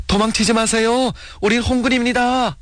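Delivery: very high, sustained call across a valley; ringing call. A narrator's reading. Ri Aengdo calls to a fleeing family, ringing high voice. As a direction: call after people to stop them, pitched very high. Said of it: "리앵도의 쟁쟁한 목소리가 골짜기를 울렸다".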